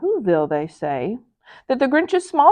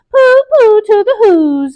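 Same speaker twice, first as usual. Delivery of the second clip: much higher, louder gloating sing-song; gleeful Grinch; 11 semitones higher, 8 dB louder than usual. Acted. The Grinch gloating on Mount Crumpet, higher and louder. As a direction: crow it like a smug villain. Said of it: "he was grinchiously humming".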